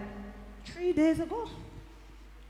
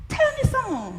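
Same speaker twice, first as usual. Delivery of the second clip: high-pitched, eager plea; eager request; 10 semitones higher than usual. Acted. Samaritan woman begs the stranger to go on, eager. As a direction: ask keenly, pitched well up. Eagerly asks Jesus for more.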